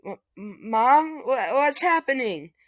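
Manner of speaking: stammering then high loud cry; scared kid. Retold or imitated. Son Deku sees lightning around mother, stammering frightened cry. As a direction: panic at the sight. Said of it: "Deku's just like".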